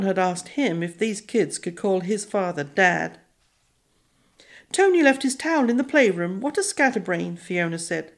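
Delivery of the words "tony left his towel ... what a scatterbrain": put-on girl voice. A narrator's reading.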